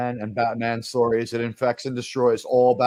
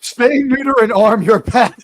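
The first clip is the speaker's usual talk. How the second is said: high, loud hammed-up ad slogan; mock slogan shout; 8 semitones higher, 9 dB louder than usual. Host mock-announcing a pet slogan, loud and high. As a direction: belt it like a goofy commercial tagline.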